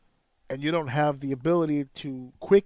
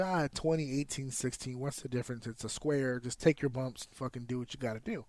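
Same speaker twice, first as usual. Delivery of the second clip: mock shrug-it-off voice, softer and tossed away; dismissive layman; 2 semitones lower, 7 dB quieter than usual. Wrestler mimicking outsiders on ring size, dismissive. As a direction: imitate people waving the issue away.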